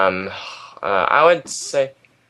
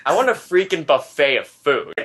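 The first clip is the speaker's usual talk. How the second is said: ordinary talk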